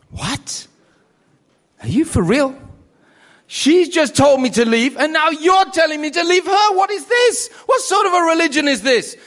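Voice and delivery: what is this, rising to an exasperated peak; incredulous outburst. Retold or imitated. Convert to the society brother, stunned and exasperated. Retold disbelief, rising outrage.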